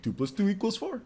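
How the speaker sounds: lightly voiced cartoon bear with rising end; a hint of cartoon bear, answering in class; Yogi Bear. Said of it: "I kept on saying".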